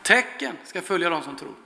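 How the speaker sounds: hushed, conclusive repeat; quiet restatement. Shown as a demonstration. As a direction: let "Tecken ska följa dem som tror" settle quietly, like a conclusion. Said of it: "Tecken, vilka tecken?".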